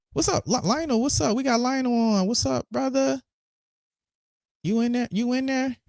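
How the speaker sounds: high call-out to a caller on the line. Real, not acted. Host to caller Lionel, high call-out.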